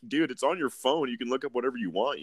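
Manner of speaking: lightly voiced pushy buddy; mock bro advice. Speaker coaxing the coworker about his phone, lightly voiced buddy.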